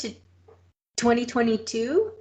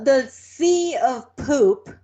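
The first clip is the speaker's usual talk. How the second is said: slow, drawn out; 4 dB louder, much slower than usual. Real, not acted. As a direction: dwell on each word slowly. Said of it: "drawn out words".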